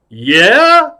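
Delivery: loud, high rising challenge; Al Pacino. Acted. Star still pressing the clown, loud high questioning. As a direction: swoop up hard and loud. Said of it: "He said".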